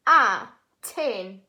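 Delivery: "A-ten-tion" in slow, loud separate chunks; "A-ten-tion" broken apart slowly, first syllable punched loud; split into syllables. Shown as a demonstration. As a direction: sound out "A-ten-tion" with a pause after each syllable. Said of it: "Good job at blending".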